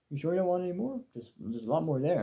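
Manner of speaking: imitating dad. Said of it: "Then he'll be like".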